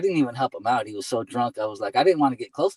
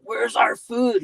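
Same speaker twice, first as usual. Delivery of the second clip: high, loud yell from down the street; 11 semitones higher, 5 dB louder, slower than usual. Retold or imitated. Drunk customer yelling out to the driver, yelling. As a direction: imitate an annoyed customer's yell. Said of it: "she's yelling out".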